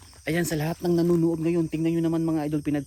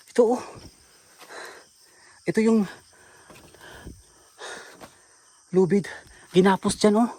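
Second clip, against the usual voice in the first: higher and louder, alarmed; excited discovery; 4 semitones higher, 5 dB louder than usual. Real, not acted. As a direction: point it out, shocked. Finds the rope, alarmed.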